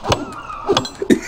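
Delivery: short high burst of laughter; real quick high-pitched laugh. Real, not acted. Streamer cracking up mid-banter, short high laugh.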